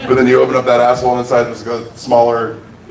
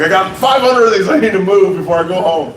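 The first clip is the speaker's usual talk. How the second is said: silly traveler voice; 7 semitones higher than usual. Retold or imitated. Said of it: "You're like".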